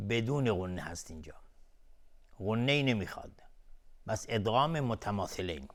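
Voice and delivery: ordinary talk, nothing marked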